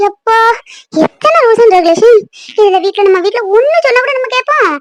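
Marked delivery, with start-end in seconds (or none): 0.1-2.2 exasperated drawn-out complaint. Acted. Complaining about rules.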